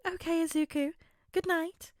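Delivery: high, gentle mother checking on son; caring mother. A narrator's reading. Mother relents.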